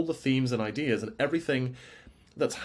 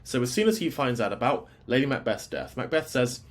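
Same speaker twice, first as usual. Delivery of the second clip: usual speaking voice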